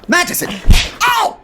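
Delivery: high, loud cry of pain; pained yelp. Acted. Holmes to Madison when she hits him, high loud yelp. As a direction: yelp as the blow lands.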